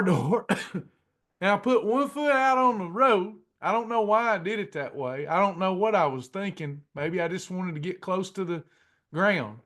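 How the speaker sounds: ordinary talk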